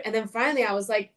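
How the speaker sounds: ordinary delivery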